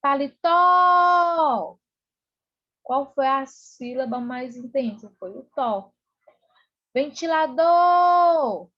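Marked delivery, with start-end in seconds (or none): 0.0-1.8 loud, high holler with a very long final tó; calling out. Shown as a demonstration. Teacher calling the word like a person's name, loud drawn-out call. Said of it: "Chama o paletó como se estivesse chamando uma pessoa". 6.9-8.7 loud, high call, last syllable held long. Shown as a demonstration. Teacher showing students the stressed syllable, calling out loudly. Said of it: "como se estivesse chamando uma pessoa".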